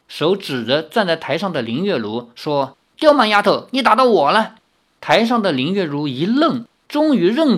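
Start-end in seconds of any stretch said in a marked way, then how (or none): 3.0-4.6 high, loud complaint; indignant shout. Acted. Young man shouting up at the girl on the stage, loud and high. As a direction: yell up at her.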